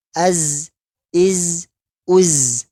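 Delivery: ordinary talk, nothing marked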